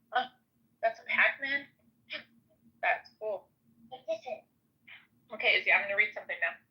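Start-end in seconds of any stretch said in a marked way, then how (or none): none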